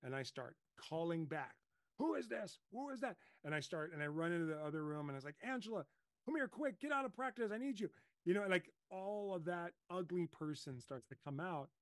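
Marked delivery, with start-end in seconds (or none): 2.0-3.2 high, frantic questions; a dad scared for his son, pitch shooting up; panicked calling back. Retold or imitated. Dad calling back, scared for his son, panicked. 5.4-7.9 high, urgent plea; panicked call for help. Retold or imitated. Dad calling wife from practice in a high panic.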